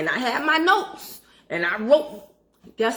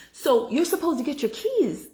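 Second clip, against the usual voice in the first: usual speaking voice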